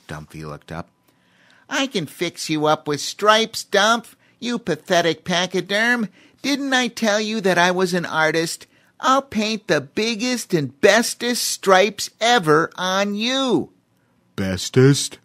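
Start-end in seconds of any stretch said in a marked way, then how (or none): 1.7-13.7 high, loud boasting chimpanzee; bragging chimp. A narrator's reading. Voices Cheemy the chimp. 14.3-15.2 a doubtful rising question; puzzled elephant. A narrator's reading. Voices Dumphy the elephant.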